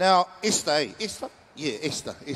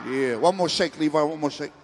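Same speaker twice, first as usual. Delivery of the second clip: ordinary delivery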